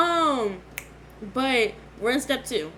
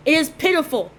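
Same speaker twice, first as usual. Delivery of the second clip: loud lament; 6 semitones higher, 8 dB louder than usual. Pretend. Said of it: "so the camera can catch it".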